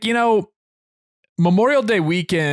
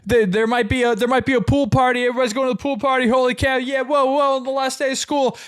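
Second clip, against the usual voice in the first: high-pitched, breathless string of exclamations; excited schoolkid; 12 semitones higher than usual. Retold or imitated. Kid hearing about a pool party, giddy high excitement. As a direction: gush like a kid on the last day. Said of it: "all the excitement".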